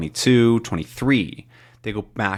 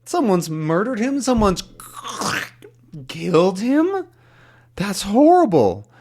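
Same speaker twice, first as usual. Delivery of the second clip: shocked question; 8 semitones higher than usual. Acted. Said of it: "he asks".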